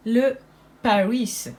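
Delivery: Paris said the English way, no French r, like a posh Londoner; Paris in a playful English accent. Shown as a demonstration. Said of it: "je sais pas pourquoi je prends un accent anglais comme ça".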